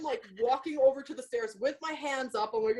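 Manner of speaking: ordinary talk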